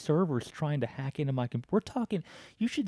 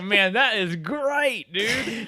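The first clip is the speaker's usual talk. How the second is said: slow, higher mock praise; sarcastic delight; 9 semitones higher, 5 dB louder, much slower than usual. After the scammer hangs up, slow sarcastic delight. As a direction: savour it sarcastically. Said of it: "I was like".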